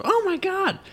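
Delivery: very high squeal of alarm; high-pitched mock panic; imitating alarmed girlfriend. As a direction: mimic her panicked shriek. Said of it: "she goes".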